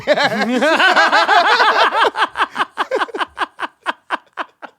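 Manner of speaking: long, high, loud laughing fit; helpless laughter. Real, not acted. Laughing over the disastrous night, helpless high cackling. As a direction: burst out laughing and keep going till it thins out. Laughing at his own awful story.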